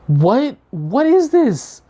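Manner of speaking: high, slow, stretched-out shock; high, drawn-out what is this; shocked disbelief. Retold or imitated. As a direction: drag out the disbelief. Kid shocked by machete teenagers.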